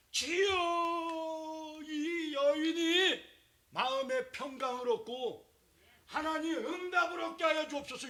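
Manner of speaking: high, loud, drawn-out priestly intoning; solemn blessing. Acted. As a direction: stretch out each word like a grand benediction. Eli's blessing prayer intoned.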